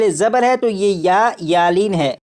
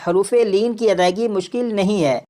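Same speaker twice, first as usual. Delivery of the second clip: normal talking voice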